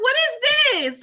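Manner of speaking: high, loud what is this; shocked outburst. Retold or imitated. Vlogger stunned by Whisper's confession, shocked outburst. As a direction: blurt it out in surprise.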